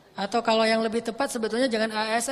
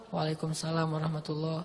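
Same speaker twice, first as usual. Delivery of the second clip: reply so softly it can barely be heard; very soft, low-voiced return greeting; hushed reply; 7 semitones lower, 9 dB quieter than usual. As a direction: answer the greeting under your breath on purpose. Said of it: "Karena saya pelankan suara".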